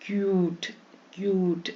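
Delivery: क्यूट with a long drawn "kyooot"; stretched yoo vowel. Shown as a demonstration. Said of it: "तो यू को हम लंबा खींचेंगे".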